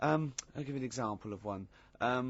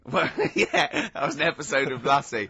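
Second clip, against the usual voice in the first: laughing; 3 semitones higher, 9 dB louder than usual. Real, not acted. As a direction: laugh out the answer. Laughing at the Lassie remark.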